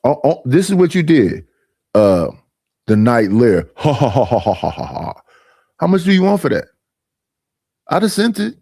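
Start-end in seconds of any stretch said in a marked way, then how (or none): 3.8-5.2 laughing, fading to nothing. Real, not acted. Reacting to the clip, real laugh fading away. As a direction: laugh and let it die away.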